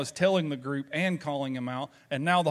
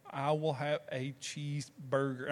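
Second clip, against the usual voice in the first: put-on slow, softer, careful non-Southern accent; carefully hide the Southern accent, word by word; attempted non-Southern accent; 6 dB quieter than usual. Southerner ordering at a California restaurant, attempting a non-Southern accent. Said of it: "trying to say it as non-Southern as I can".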